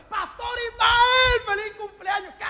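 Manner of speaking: high, stretched-out call on the name; gushing birthday greeting. Acted. Congregant greeting the pastor on his birthday, mock-excited. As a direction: hail the pastor with hammed-up delight.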